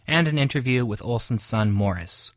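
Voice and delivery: loud, polished radio announcer reading a review; booming broadcast review voice. Acted. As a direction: present it like a radio host, crisp and loud. Radio review read, music at end.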